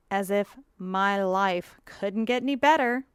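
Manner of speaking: slow, drawn-out sarcasm; sarcastic. Acted. Blogger on lifelong medication, sarcastic. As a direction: stretch it out with dry mock delight. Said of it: "That last part was sarcasm".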